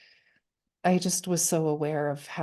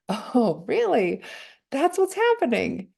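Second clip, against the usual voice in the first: gentle, generous tone; 6 semitones higher, 3 dB louder than usual. Shown as a demonstration. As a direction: greet it kindly, a delighted gentle surprise. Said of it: "and then I hear it this way".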